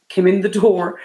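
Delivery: ordinary talk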